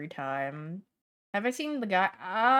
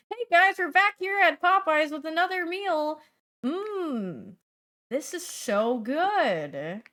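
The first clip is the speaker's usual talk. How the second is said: hyped reviewer intro; 8 semitones higher, 4 dB louder than usual. Retold or imitated. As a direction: open a review video with fake hype.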